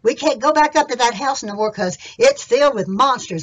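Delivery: loud, urgent warning to the gang; frightened outlaw, full volume; alarmed robber. Acted. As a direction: holler the news to the boys. Voices the fleeing robber's report.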